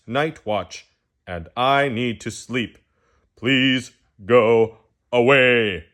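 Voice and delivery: a tired owl snapping at the pest who woke him; grumpy owl. Acted. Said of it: "Owl really got grumpy and just, whew, maybe overdid it".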